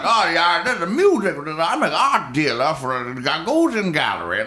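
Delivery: high, affected art-dealer voice; pretentious guy. Retold or imitated. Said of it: "and he's like".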